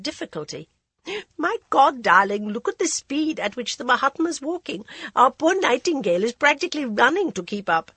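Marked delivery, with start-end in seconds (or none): 1.4-7.9 high, loud gushing socialite; society lady voice. Acted. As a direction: gush like a grand lady. Socialite remarking on pace.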